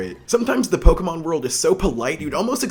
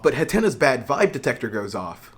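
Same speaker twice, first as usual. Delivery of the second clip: usual speaking voice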